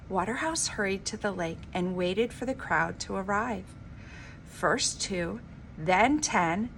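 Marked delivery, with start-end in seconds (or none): none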